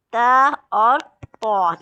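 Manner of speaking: ता thin and तो thick, both slow and loud; teacher contrasting thin ता and thick तो. Shown as a demonstration. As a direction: draw ता out lightly, then तो heavy. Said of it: "इसमें ऐसे पढ़ना है इसको".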